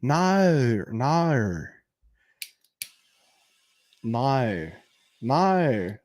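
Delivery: drawn-out Australian "no"; imitating an Australian saying "no"; Australian accent. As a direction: stretch each "no" into an Australian diphthong. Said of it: "That's how she said no".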